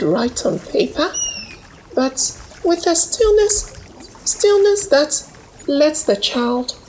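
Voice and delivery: high, tender telling of what the river gave; Amina's voice. A narrator's reading.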